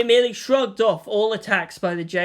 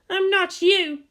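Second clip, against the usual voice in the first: Asuka, brash; 13 semitones higher, slower than usual. A narrator's reading. Asuka to Shinji before launch, high and brash. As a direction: show off before the fight.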